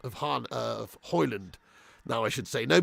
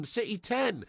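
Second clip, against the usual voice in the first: normal talking voice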